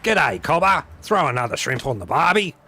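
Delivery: hammed-up Aussie accent, throat-straining; overly Australian accent. Shown as a demonstration. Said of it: "Should I be more like that?".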